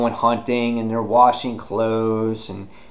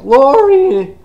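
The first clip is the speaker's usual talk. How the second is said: high, loud mock holler; calling out; 10 dB louder than usual. Rick calls to his wife, high loud mock yell. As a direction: call a name far across camp.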